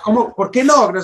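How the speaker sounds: high-pitched retold disbelief; incredulous. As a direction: act out being baffled by a refusal. Replays disbelief at taxi refusal.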